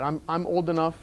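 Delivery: ordinary talk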